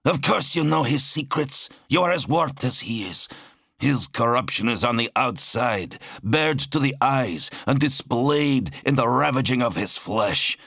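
high-pitched, indignant tirade; snapping angrily. A narrator's reading. Zal condemns his brother's hidden corruption, snapping. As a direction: accuse him hotly, pitch held high. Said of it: "Zal snapped".

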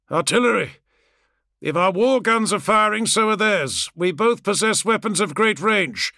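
high, declamatory soldier's report; confused warrior reliving battle. A narrator's reading. Confused warrior's involuntary battlefield words.

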